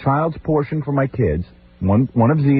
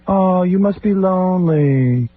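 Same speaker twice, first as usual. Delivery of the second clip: higher, very slow, drawn-out sympathy; mock pitying sister; 8 semitones higher, much slower than usual. Brother mocks sister's visit, syrupy slow pity. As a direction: overdo fake pity like a condescending sibling. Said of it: "my sister walked in last night".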